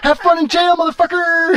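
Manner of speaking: loud high mocking yell at the thief; yelled taunt. Taunting the car thief in the patrol car, loud gleeful yell. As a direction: shout it in his face, gleeful.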